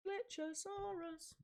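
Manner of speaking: soft, high, slow squeal of a name; tiny high-pitched creature-like call; mock monster name call. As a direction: squeak the silly name out very softly. Mock wrestling name, echoed.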